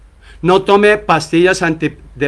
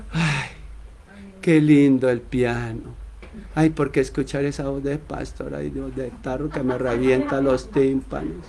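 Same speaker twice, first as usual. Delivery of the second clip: soft, swooning sigh at every word; dreamy swooning congregant; 3 semitones lower, 8 dB quieter than usual. Retold or imitated. Churchgoers gushing over the pianist, swooning softly. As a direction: melt over the piano like a smitten listener.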